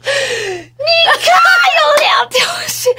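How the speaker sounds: high, loud, stretched-out wail; frantic shout. Retold or imitated. Patient showing nurse her tests, frantic high wail. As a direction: cry it out slowly and shrilly.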